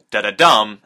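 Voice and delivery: "da-da-dum" with the last "dum" punched; two light beats, then hit the third; anapestic beat. Shown as a demonstration. Teacher tapping out anapestic meter for the class. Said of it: "two unstressed syllables followed by one stressed".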